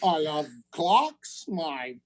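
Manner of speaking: mock British accent. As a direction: put on a caricature British voice. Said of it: "the British accent is either".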